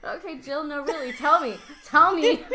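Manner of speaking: pestering dad. Retold or imitated. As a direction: needle her, pushier each time. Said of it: "picking at her a little".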